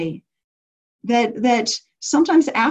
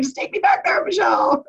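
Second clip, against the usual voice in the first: much higher, a mock crying wail; hammed-up imitation of a participant begging the teacher; whiny begging; 9 semitones higher than usual. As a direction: plead like a kid who wants the trip back.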